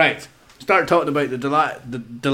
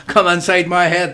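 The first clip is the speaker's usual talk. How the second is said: spooky announcer; 5 semitones higher, 7 dB louder than usual. Pretend. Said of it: "That's the Twilight Zone".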